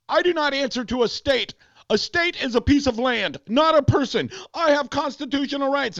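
high-pitched mock indignation; mock a driver declaiming his rights; self-righteous protest. Sovereign citizen arguing with cop, high self-righteous protest.

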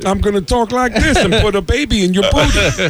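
high, laughing Tracy Morgan voice; goofy Tracy Morgan bit. Retold or imitated. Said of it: "If I do Tracy Morgan".

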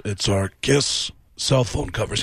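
slow, low deadpan rock star voice; flat, slow sales pitch. Retold or imitated. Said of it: "And [name] apparently goes".

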